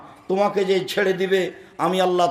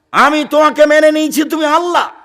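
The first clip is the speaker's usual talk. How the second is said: fervent loud prayer; 9 semitones higher, 8 dB louder than usual. Acted. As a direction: cry out the prayer with full force. Preacher voicing prayer in loneliness. (উচ্চস্বরে আবেগময় প্রার্থনা)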